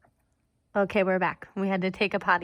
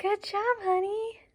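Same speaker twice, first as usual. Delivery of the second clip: dog voice; 12 semitones higher than usual. Real, not acted. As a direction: praise the puppy sweetly, up high. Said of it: "my dog voice".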